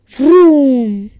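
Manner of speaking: very loud motorcycle vroom; big roaring vroom, long and falling. A narrator's reading. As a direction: let the engine noise swell, then fall away. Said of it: "The roaring got louder".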